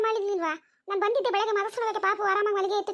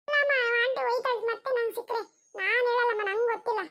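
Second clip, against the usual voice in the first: put-on a little girl keeping a secret, giggling; child Chinnu refusing to tell her mother, in a high giggly voice; 5 semitones higher than usual. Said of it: "ಏ ಚಿನ್ನು".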